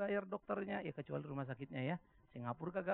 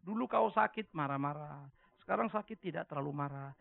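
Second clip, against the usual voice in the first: normal talking voice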